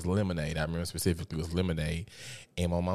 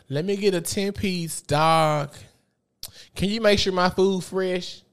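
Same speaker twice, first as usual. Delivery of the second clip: high, mock bossy mom ordering chicken; mimicking mom; 10 semitones higher, 4 dB louder than usual. Son mimics his mom at the drive-thru, high and bossy. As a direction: play mama placing her order.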